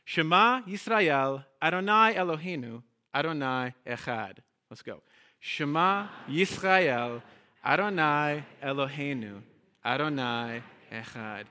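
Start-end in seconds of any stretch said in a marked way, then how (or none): 0.1-4.4 slow, measured "Shema Yisrael, Adonai Eloheinu, Adonai Echad"; slow Hebrew recitation. Shown as a demonstration. Teacher reciting the whole Shema for the congregation, slow. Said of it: "Let's try this". 5.4-11.5 very slow, drawn-out Hebrew recited together; slow unison recitation. Shown as a demonstration. Teacher reciting the Shema together with the congregation, very slow. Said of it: "I'll say it along with you".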